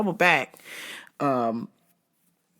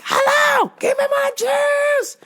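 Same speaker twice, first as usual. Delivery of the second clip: much higher and louder, slow; screaming demand; 3 dB louder, slower than usual. Retold or imitated. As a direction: shriek at the clerk for juice. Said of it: "She's like".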